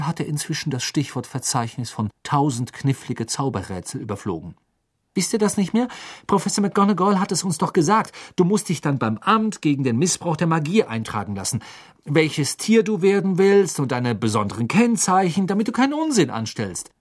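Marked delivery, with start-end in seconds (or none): 5.1-16.9 much higher and louder know-it-all scolding; Hermine, lecturing. Acted. Hermine reminding the boys of McGonagall's rules, higher and louder. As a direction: reel off the rules like a prefect.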